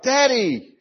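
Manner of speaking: high-pitched call of delighted kids, imitated; retold surprised cry. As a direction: mimic children calling out to their dad. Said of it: "they both cried out".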